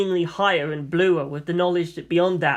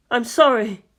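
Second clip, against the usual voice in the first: tearful apology; 5 semitones higher, 4 dB louder than usual. A narrator's reading. Crying boy apologises.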